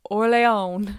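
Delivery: Orleans as or-lay-ON, French-like; hesitant pronunciation. Shown as a demonstration. Naming the game she hates saying. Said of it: "hate saying this word".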